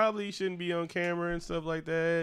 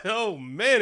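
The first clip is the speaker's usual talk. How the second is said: ordinary delivery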